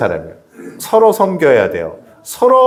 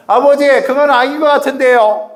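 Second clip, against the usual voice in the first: put-on sassy child reply; 9 semitones higher than usual. Child talking back to father.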